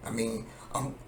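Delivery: normal talking voice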